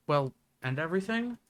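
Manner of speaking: ordinary talk, nothing marked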